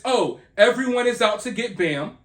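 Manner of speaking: usual speaking voice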